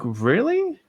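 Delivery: high, rising incredulous question; happy disbelief. Retold or imitated. Dad learns of the pregnancy, happily incredulous. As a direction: imitate a delighted dad who can hardly believe it. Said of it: "he was happy".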